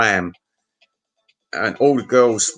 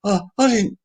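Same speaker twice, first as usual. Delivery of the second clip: high, squeaky mock-frightened voice; terrified denial; 11 semitones higher than usual. Accused drunk to the bouncers, mock-terrified denial. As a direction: plead innocence in a comically high imitated panic. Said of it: "he got all, like, terrified".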